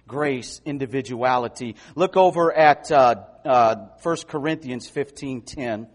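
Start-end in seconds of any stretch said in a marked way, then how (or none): none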